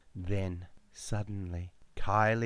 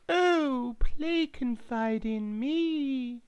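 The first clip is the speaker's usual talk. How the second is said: very high, slow sheep voice; talking sheep; much slower than usual. Acted. Line-dancing sheep asking for the song, high and slow. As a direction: bleat it out like a silly sheep. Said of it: "one sheep said".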